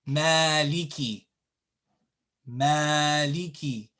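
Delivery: maliki with a long first a; maliki, first syllable stretched; long vowel. Shown as a demonstration. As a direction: hold "maa" in maliki. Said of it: "read when you say it".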